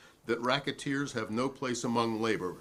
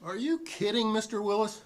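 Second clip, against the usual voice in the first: incredulous retort; 9 semitones higher than usual. Real, not acted. Witness to questioning congressman, incredulous and raised. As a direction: scoff at the question in real disbelief.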